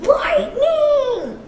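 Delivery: high ringing shout. Acted. Jay shouting lightning, high. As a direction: shout the element out. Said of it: "shouted Jay".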